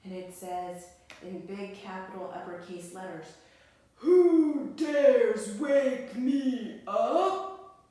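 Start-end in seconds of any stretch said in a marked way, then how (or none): 4.0-7.9 very loud, slow bear roar; furious bear bellow; angry growl. Acted. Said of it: "growled the bear angrily".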